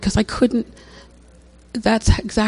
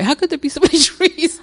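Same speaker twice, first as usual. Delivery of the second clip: laughing; 7 semitones higher than usual. Real, not acted. Laughing at own confusion.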